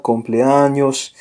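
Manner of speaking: «cumpleaños» slowly, with ñ as in canyon. Shown as a demonstration. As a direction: stretch «cumpleaños» so the ñ sounds like ny. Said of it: "it almost sounds like ñ, like in canyon".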